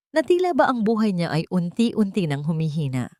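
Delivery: usual speaking voice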